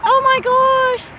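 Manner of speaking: high, delighted cooing. Real, not acted. Owner delighted by parrot.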